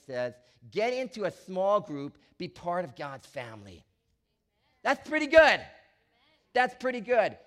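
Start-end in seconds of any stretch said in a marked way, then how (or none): 4.8-7.4 rousing preacher. Acted. Pastor rousing congregation.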